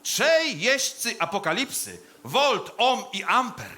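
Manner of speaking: slow, high, loud declamation; hammed-up graveside proclamation; pompous funeral oration. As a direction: draw out each phrase like a grandiose eulogist. Delivers a funeral speech.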